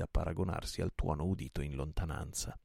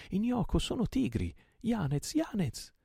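high, jubilant calls; excited calling; 3 dB louder than usual. A narrator's reading. Captain calls to his comrade and friend, high excited cries. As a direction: call out the names high and eagerly.

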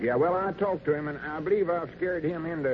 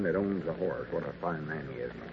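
much softer, lower, quick prompting; feed the line without the caller hearing; hushed prompt; 8 semitones lower, 8 dB quieter than usual. Acted. Whispered coaching beside the phone.

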